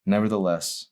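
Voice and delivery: ordinary delivery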